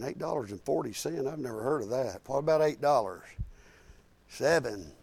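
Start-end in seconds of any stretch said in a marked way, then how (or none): 0.0-1.3 quiet disbelieving question, "forty" rising; incredulous echo. Retold or imitated. Thinking over the teen's odd gas order, quietly incredulous. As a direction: repeat the odd sum back in quiet disbelief. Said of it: "I'm thinking".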